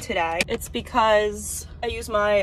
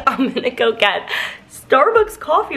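corrected with a laugh; 3 semitones higher, 7 dB louder than usual. Real, not acted. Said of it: "did I just say sourdough coffee?".

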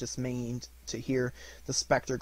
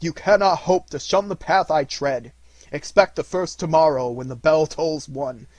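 loud, raised, solemn warning; commanding ghost; 5 semitones higher, 11 dB louder than usual. A narrator's reading. Ghost foretells the first spirit to Scrooge, commanding. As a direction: pronounce it forcefully, slowing to a close.

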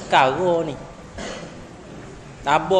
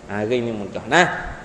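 usual speaking voice

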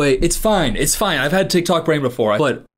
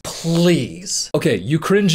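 usual speaking voice

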